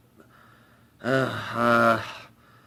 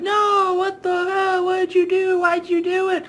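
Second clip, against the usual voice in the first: very high, hammed-up anguished cry; mock shrieking grief over the body; shrill mock wail. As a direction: squeal it like a campy soap scene. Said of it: "they're like".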